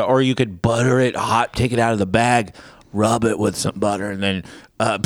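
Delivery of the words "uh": ordinary talk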